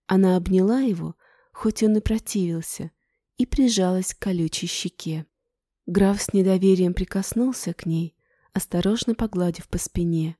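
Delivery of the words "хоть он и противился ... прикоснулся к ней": ordinary delivery, nothing marked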